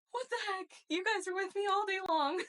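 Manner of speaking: high-pitched mock exasperation; exasperated retort. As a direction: protest in a high, incredulous voice. Retells exasperated reply to kids.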